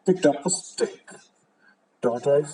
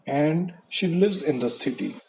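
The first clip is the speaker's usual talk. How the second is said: ordinary delivery, nothing marked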